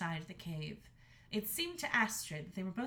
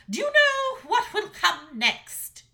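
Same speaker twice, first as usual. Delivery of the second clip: high, loud furious snarl; 12 semitones higher, 15 dB louder, slower than usual. A narrator's reading. Mother dragon snarling at her son, high and loud. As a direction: bite every word at him.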